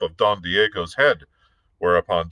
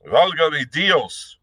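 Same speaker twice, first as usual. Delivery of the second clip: slow, higher anguished cry; a cry of despair to God; 8 semitones higher, 3 dB louder, slower than usual. Acted. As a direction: Don Diego crying out in anguish, slow and higher. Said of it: "he cried out, like a man in anguish".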